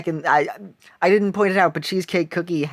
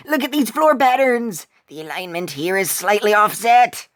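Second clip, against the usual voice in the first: character voice; 4 semitones higher, 4 dB louder than usual. Acted. Voicing detective Walnut Cookie.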